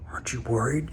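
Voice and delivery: imitating worried peers in a hushed whisper. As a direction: breathe it out like a nervous classmate. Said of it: "all saying".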